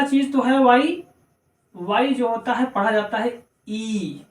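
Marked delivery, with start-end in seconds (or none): 3.7-4.2 ई as a drawn-out long ee; long ee sound. Shown as a demonstration. Teacher showing how Y is read, long ee. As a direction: hold ई as a long ee vowel. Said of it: "बड़ी ई की आवाज देता है".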